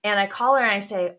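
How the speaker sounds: ordinary delivery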